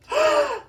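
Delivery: sharp high gasp on the verge of tears; high gasping sob of delight; tearful gasp. Real, not acted. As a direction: gasp in overwhelmed joy. Canvas reveal.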